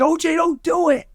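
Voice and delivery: high-pitched imitated pleading; retold plea. Friends begging a man holding a gun, retold pleading. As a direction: plead with him, half-acted.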